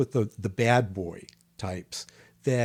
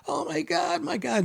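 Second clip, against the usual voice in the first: much higher, mock horrified gasp of words; retold shock. After punching his partner for the first time, imitated shocked disbelief. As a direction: replay the moment you realise what you did. Reacting to hitting Brian.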